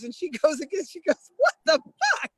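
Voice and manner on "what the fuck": very high, loud mock outburst; incredulous outrage. Friend hears of the handlebar ticket, incredulous outrage. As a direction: imitate a friend's outraged disbelief.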